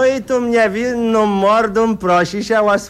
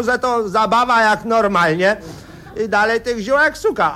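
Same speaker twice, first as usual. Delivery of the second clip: usual speaking voice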